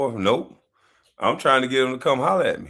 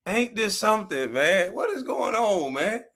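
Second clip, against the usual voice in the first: amused surprise; 10 semitones higher than usual. Real, not acted. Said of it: "mock surprise at returning viewers".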